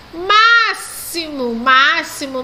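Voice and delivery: máximo: x als s, ma-si-mo, traag; máximo stretched, s sound; slow model word. Shown as a demonstration. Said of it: "com o som de S".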